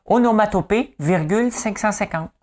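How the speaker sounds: very high, put-on quiz answer; game show contestant impression. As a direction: announce it in a comic high voice. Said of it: "Des chiffres et des lettres".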